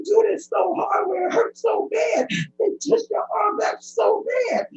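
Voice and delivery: high, tearful, near-shouting plea; real tears breaking through a retold plea; emotional pleading. Real, not acted. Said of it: "I'm sorry for being emotional".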